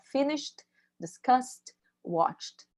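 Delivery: finished, discussed, watched each end in a t sound. Shown as a demonstration. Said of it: "som de D ou de T no final".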